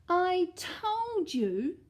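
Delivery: high loud slow cry. Acted. Eddie to Mum as the bear appears, loud slow cry. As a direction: cry out I told you. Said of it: "cried Eddie".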